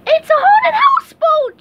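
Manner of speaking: very high, loud terrified shriek. Acted. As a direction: scream it in panic. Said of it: "shrieked SpongeBob".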